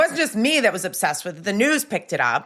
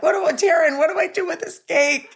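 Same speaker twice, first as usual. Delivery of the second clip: higher-pitched imitation of a flustered friend; lightly mock a stressed-out friend, pitched up; Tanya stressed out; 8 semitones higher than usual. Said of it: "She's probably so stressed out knowing Tanya".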